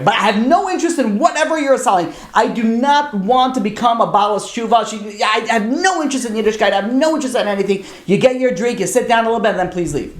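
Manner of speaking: high-pitched, emphatic protest in a retelling; adamant refusal. Retold or imitated. Daughter warning the student she has no interest, retold adamantly. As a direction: act out laying down firm terms.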